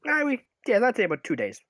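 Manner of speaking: a lightly voiced halfling merchant, unhurried.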